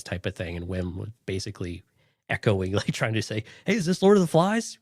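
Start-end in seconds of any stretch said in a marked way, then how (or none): 3.7-4.8 higher, louder, playful call-out; mock exclaimed question. Imitating Wim asking about Lord of the Flies, playful. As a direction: toss it out like a startled kid. Voicing Wim's question, jokingly.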